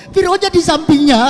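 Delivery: excited punchline. Real, not acted. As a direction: burst out with the comic twist. Punchline: Pharaoh beside her.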